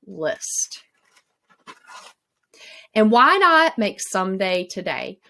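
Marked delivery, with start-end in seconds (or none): none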